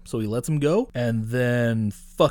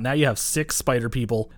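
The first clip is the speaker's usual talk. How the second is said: ordinary talk, nothing marked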